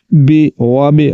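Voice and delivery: बी with a pure short i, drawn slow; slow and clear. Shown as a demonstration. Teacher showing the correct kasra vowel. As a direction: say बी slowly with i. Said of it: "बी पढ़ना है".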